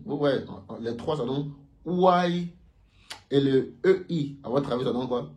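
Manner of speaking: ordinary talk, nothing marked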